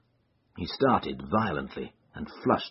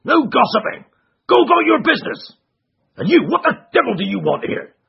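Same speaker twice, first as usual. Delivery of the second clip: much higher, louder, fast furious scolding; angry trainer; 11 dB louder, faster than usual. Acted. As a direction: drive them off in a rage.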